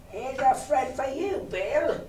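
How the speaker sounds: high-pitched sample cry; high, softish singsong call; mock announcement. A narrator's reading. Beggar models the words to cry.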